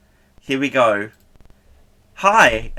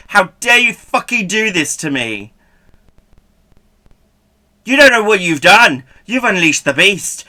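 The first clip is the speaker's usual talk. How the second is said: loud, high angry outburst; hammed-up furious shouting at the viewers; 4 semitones higher, 10 dB louder than usual. Shown as a demonstration. Streamer shows chat her angry face, furious tirade. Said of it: "Do you wanna see me angry?".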